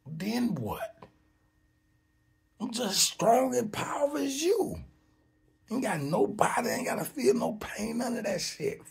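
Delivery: high, insistent challenge; defiant boast. Acted. Bodiless spirit claiming equal power, high and defiant. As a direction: brag back at them, pitched well up.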